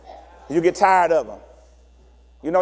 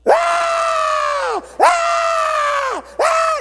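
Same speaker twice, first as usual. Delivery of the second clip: put-on high piercing toddler scream; child screaming; 3 dB louder, much slower than usual. Anxious child screaming for a parent. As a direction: wail like an anxious baby.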